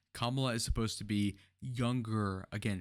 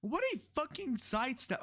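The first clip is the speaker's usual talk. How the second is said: shrieking what, much higher; shocked outrage. Real, not acted. Streamer reacts in disbelief to Walz's sidestep, voice shooting up.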